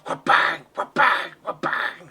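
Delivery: loud, rhythmic bangs like knives; chopping sound effect. Acted. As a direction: beat out the knife blows. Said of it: "you hear a couple of".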